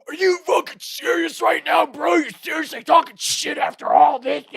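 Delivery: very high, loud outraged yelling; mock rage fit. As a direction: rant like a jock mid-tantrum. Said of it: "just had his full rage fit's like".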